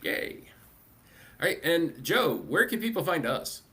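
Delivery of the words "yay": normal talking voice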